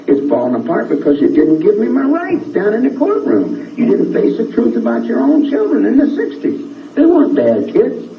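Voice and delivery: real high-pitched indignation; worked-up, insistent defence; heated grievance. Real, not acted. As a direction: argue their case with pitch held high. Heated defence of himself and followers.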